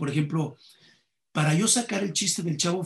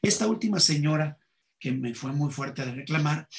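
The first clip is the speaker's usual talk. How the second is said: usual speaking voice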